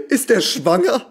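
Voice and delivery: high, slow laughing question; laughing disbelief. Real, not acted. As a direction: laugh it out, stunned. Repeats it laughing.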